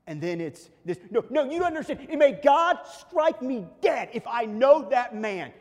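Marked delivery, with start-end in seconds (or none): 1.1-5.5 high, loud shouting with hammered words; vehement shouted denial. Acted. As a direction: act out a desperate escalating oath at full volume. Peter's final denial, escalated and acted.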